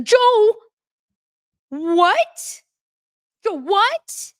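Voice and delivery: high, loud mock-outraged squeal; freaking-out fiancée. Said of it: "without her freaking out".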